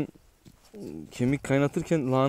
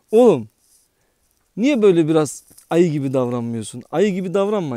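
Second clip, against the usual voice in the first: scolding shout; 3 semitones higher, 7 dB louder than usual. Real, not acted. Scolding the dog.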